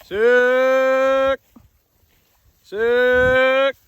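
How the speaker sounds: long high hollering cattle call. Real, not acted. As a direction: holler out across the pasture. Calling cows to come.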